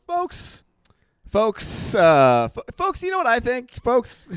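high, halting folks folks; hammed-up awkward host opener; mock podcast host. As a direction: stammer like a nervous host. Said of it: "it was just me going".

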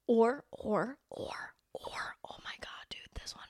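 hushed, conspiratorial whisper. Real, not acted. Whispering to listeners about a friend who makes her angry. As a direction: lean in and whisper it like a secret.

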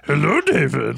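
put-on puppet character voice; playful impression of a puppet performer's character; Frank Oz voices. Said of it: "all of the different voices".

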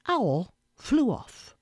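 ordinary delivery, nothing marked

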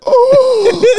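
a teasing falsetto ooh; high mock ooh. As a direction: squeal it before running off. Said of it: "Dennis Schroeder's just like".

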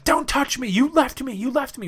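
high, hurt, accusing; upset girlfriend. Retold or imitated. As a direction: retell her yelling, you left me. Girl scolds him on the beach.

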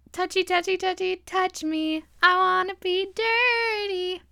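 high breathy-free sultry purr; sultry Janet voice. Retold or imitated. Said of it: "she's like".

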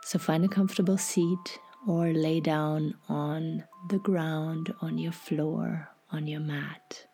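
half-whispered, unhurried guidance; guided meditation. Acted.

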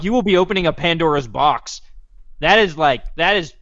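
normal talking voice

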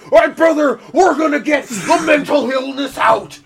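high, loud wrestler shout; hammed-up wrestling promo yell; Hulk Hogan. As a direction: bellow it like a wrestler hyping a bout. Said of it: "he looks like the Hulk Hogan".

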